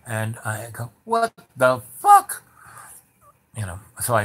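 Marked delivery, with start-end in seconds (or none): 1.0-2.4 high, loud, stunned exclamation; a sudden cry of shock; shocked outburst. Retold or imitated. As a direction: blurt it out in disbelief.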